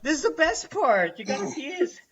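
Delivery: high-pitched disbelief, acted out; hammed-up baffled urging; incredulous pleading. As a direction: beg her to stay for the best bit. Acts out pleading with leaving ex.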